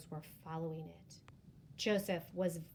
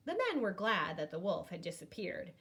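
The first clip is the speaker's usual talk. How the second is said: usual speaking voice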